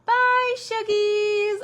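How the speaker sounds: put-on high, loud little child's voice; toddler saying bye. Grandson calling goodbye to his Shuggies, high and bright. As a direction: chirp it like a tiny grandson. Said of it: "the first time he was like".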